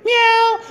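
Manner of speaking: very high imitated meow; high-pitched mock mew; cat meow. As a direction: mimic a cat's meow. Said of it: "My cat sits there and goes".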